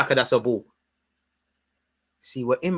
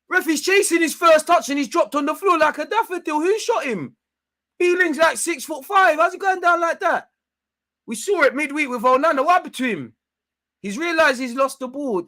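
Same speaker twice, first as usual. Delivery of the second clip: much higher, louder mocking outrage; incredulous rant; 13 semitones higher, 5 dB louder than usual. Real, not acted. Fan mocking a player who went down easily, high incredulous rant. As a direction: squeal in disbelief at the dive. Ranting at diving opponent.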